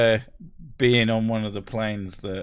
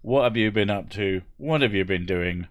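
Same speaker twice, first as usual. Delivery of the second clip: ordinary talk, nothing marked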